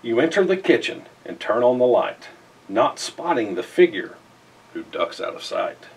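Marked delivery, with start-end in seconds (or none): none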